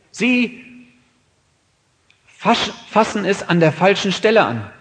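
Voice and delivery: loud, high, impassioned sermon voice; forceful preaching. Acted. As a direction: hammer it home from the pulpit. Preacher rails against false effort.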